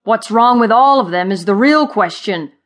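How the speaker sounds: loud, cross creature retort; growling. A narrator's reading. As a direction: growl back a grumpy correction. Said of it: "Thud growled from below".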